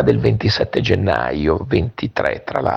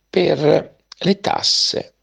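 usual speaking voice

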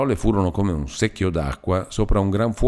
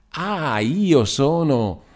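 mocking sing-song; 7 semitones higher, much slower than usual. A narrator's reading.

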